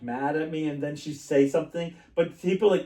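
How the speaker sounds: normal talking voice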